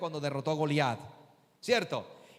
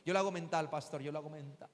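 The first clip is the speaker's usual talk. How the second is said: timid congregant; 5 semitones lower, 7 dB quieter, faster than usual. Pretend. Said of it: "No, no, no, cálmate".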